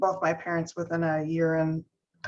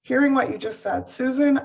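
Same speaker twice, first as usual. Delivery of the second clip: normal talking voice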